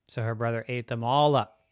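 ordinary delivery, nothing marked

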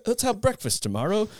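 high-pitched, badly faked Australian; botched Australian accent. Guest proposing breakfast tomorrow, botched Australian accent. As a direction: have a poor go at sounding Australian.

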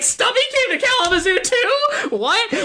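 high, laughing disbelief; incredulous surprise. Real, not acted. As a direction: squeak it out through laughter, baffled. Baffled by the movie.

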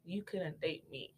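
normal talking voice

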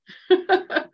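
bright burst of real laughter; relieved laugh. Real, not acted. Host laughs to her guest once the connection returns, a relieved laugh. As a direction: laugh with relief that the call is back.

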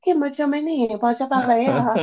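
high mock-Spanish child voice; mock foreign-language girl. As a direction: imitate a little girl in made-up Spanish. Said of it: "she like this".

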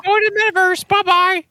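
very high put-on voice; high-pitched mock farewell; dismissive send-off. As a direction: chirp a high goodbye to get rid of someone. Acting out Tim Spooky's brush-off.

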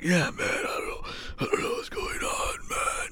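hoarse, broken whisper with cracks; imitated lost voice. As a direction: strain to talk as if the voice is gone. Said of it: "I lost my voice really bad".

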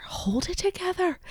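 very high, soft squeak of self-command; pep yourself up in a tiny high voice; high self-coaxing. Real, not acted. Host telling herself not to cry, squeaky self-coaxing. Repeats it, pitched far up.

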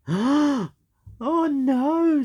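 put-on high dismayed old shark; Grandpa Shark. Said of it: "said Grandpa Shark".

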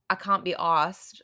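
put-on amateur British accent, broad "ah" in can't. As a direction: attempt "can't be arsed" like a Brit. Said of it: "I probably said that terribly".

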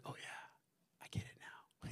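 hushed, breathless whisper of awe; awed whisper. Retold or imitated. Preacher recalling the chapel view, in an awed whisper. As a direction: whisper it like the view might vanish.

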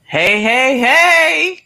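high and loud, rising each hey; Fat Albert hey hey hey. Retold or imitated. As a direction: belt out a cartoon hey hey hey. Bill Cosby catchphrase impression.

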